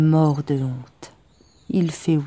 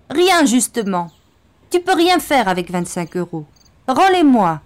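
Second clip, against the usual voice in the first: louder, defiant comeback; defiant retort; 6 semitones higher, 4 dB louder than usual. Acted. Bastien retorts to the gang leader: defiant, loud. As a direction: stand up to the bully. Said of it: "trouve le courage de riposter".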